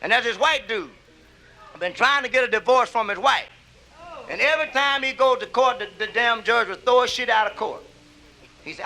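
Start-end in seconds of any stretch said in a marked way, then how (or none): none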